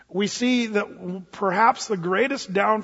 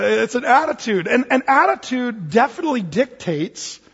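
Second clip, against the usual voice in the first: ordinary talk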